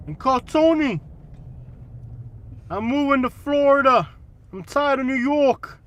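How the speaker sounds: hammed-up New York mobster accent. Shown as a demonstration. Answering a request to do New York, loud New York accent. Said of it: "Can you do a New York accent?".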